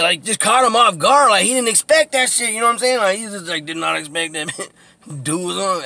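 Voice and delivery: ordinary talk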